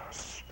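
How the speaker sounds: soft "shh"; shushing. Acted. Friends silence the shouting beggar, shushing. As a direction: hush someone with a soft hiss of air.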